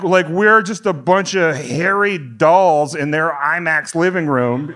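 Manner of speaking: usual speaking voice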